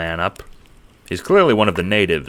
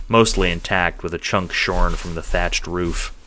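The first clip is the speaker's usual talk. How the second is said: usual speaking voice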